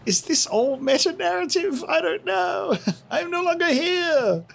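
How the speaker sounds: high-pitched, laughing disbelief. Real, not acted. Player cracking up at the game's meta-narrative, laughing. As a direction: squeal it through real laughter.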